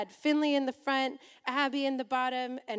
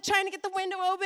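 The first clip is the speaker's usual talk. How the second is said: ordinary talk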